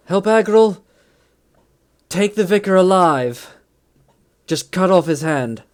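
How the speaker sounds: shouted orders. Acted. NPC shouts orders in battle.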